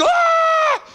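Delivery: very high sustained squeal; mock scared shriek. Kid on a loping horse, mock scared shriek. Retold kids as horse lopes.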